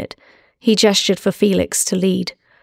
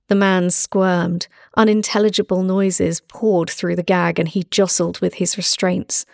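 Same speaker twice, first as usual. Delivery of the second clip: usual speaking voice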